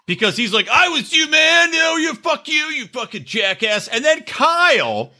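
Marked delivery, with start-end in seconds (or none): 0.7-3.9 high-pitched ranting imitation; high, heated mock tirade; mock drunken yelling. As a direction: hurl insults as a hammed-up angry drunk. Acting out Carl yelling at Luke.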